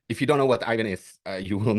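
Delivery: normal talking voice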